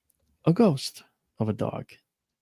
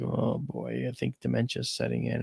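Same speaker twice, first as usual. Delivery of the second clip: soft, hushed aside; hushed worried aside between parents; worried mutter; 8 dB quieter than usual. Retold or imitated. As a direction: murmur it under your breath as if she can't hear. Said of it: "look at each other like".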